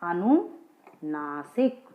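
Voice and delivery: very slow "अनुनासिक", each syllable drawn out, rising at the end; teacher stretching "अनुनासिक" slowly so kids can repeat it; slow careful term. Shown as a demonstration. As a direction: spell out "अनुनासिक" slowly for the class. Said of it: "इसको क्या बोलते हैं हम?".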